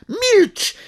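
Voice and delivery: sharp loud barked order to silence; thundering shout. A narrator's reading. Father roaring at his son to be silent. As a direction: bellow it at the son. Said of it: "huknął profesor".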